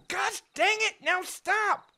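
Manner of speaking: ordinary talk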